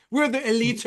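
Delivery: high, lightly hammed British boast; mock British accent. British fan boasting of the league, mock British accent. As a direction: proclaim it in a put-on British voice. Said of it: "If you're British, imagine you're like".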